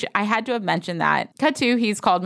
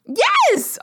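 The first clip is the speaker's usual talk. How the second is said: emphatic yes; 4 dB louder than usual. Real, not acted. Agreeing emphatically, laughing conversation.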